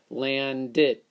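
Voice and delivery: LANDED ending in a T sound, landet. Shown as a demonstration. Teacher trying the t ending on landed. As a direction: say landed as if it ends in T. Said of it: "Doesn't sound right".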